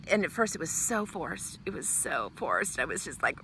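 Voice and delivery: ordinary talk, nothing marked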